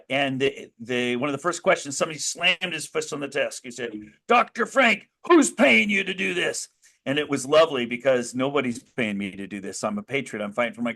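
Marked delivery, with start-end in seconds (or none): 4.3-6.7 raised, higher voice of an accusing legislator; angry accusation. Retold or imitated. Legislator slamming his fist, accusing the speaker. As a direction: demand it like you just slammed your fist.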